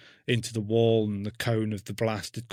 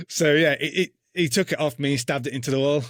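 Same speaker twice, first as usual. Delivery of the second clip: ordinary delivery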